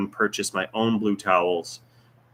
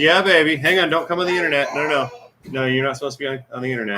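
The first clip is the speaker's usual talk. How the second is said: higher, louder voice for a little one; 5 semitones higher, 4 dB louder, much faster than usual. Real, not acted. Parent calling to upset child.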